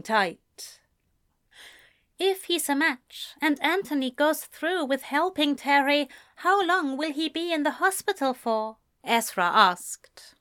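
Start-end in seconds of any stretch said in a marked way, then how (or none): 2.2-8.8 higher-pitched questioning character; higher voice for the boyfriend; lightly voiced young boyfriend. A narrator's reading. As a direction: raise the pitch for a concerned question. Ezra voiced in story.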